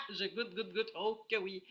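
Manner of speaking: loud laugh breaking into the words. Real, not acted. Reviewer laughing at tripping over her words. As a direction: laugh through the repeated word.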